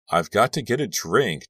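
plain reading voice

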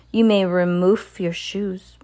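witch's command. Acted. Grand High Witch ordering witches, slow stern command. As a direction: lay it down coldly, word by word. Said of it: "marked the Grand High Witch".

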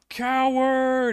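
high, drawn-out taunting yell; mock battle yell. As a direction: yell it out like a fleeing champion taunting. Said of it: "he'll yell".

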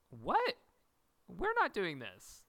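high What then slow refusal; mock shock. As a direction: act appalled, then refuse slowly. Quoting Maddie, shocked.

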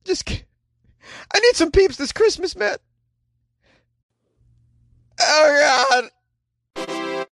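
high, whiny mock-tragic lament; mock sobbing whine. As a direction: wail like the world is ending, hammed up. Whining over lost pumpkin Peeps.

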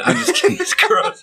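high laughing voice. Real, not acted. Laughing after teasing his friend. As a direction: laugh the words out.

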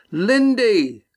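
high, falling yell of her name. A narrator's reading. Estrella calling to Lindy, high yell. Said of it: "Estrella yelled".

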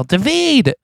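high yelled name across the water; shouting for him from the raft. Retold or imitated. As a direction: call out his name high and sharp. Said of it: "and you yell".

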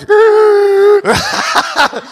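high, drawn-out mock gasp for air; winded gasp. Winded boxer trying to breathe for the medic, hammed-up gasp. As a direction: strain to suck in a breath.

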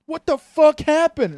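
high, loud, slow fake horror; fake shock. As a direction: ham up innocent shock. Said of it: "he's like".

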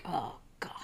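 exasperated whisper under her breath; muttered oh God, nearly silent; whispered exasperation. Retold or imitated. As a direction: breathe it out, fed up and hushed. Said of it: "and I'm like".

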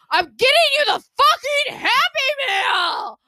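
high, slow furious shout; screaming at daughter. Retold or imitated. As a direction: yell it at the kid, word by word. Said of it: "we were screaming at each other".

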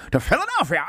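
high-pitched put-on delivery; mock character voice. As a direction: pitch it up like a playful impression. Mimicking a character's voice.

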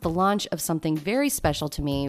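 upbeat promo read; tourism ad. Acted. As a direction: sell the trip like a radio spot. Host reads travel agency ad.

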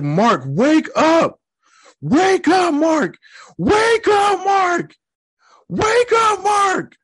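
high-pitched, drawn-out scream at the screen; frantic re-enacted screaming. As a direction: scream to wake him, voice way up high. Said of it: "This is when I started screaming".

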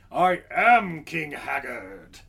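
slow, grandly announced king. Acted. King Haggard revealing himself to visitors, grandly. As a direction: proclaim it like royalty, drawing words out. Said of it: "and they're like".

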